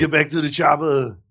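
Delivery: thick mock Austrian accent; hammed-up Austrian movie-star voice; Arnold Schwarzenegger. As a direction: do an action-hero Austrian accent. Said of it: "our Schwarzenegger on the new Terminator commercial".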